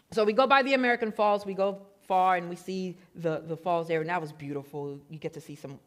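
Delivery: ordinary talk, nothing marked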